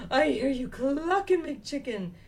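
gasp, then loud, slow joyful chirp; relieved chick. A narrator's reading. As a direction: burst out with relief at finding Mama. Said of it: "he's so relieved".

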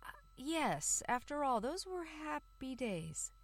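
wistful. Acted. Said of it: "he said".

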